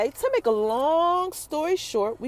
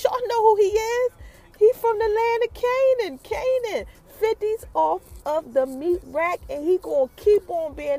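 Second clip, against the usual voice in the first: higher preacher-like proclaiming voice; mock preacher; 6 semitones higher than usual. Calling Fifty out to viewers, mock preacher proclaiming. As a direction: preach it like scripture, half joking.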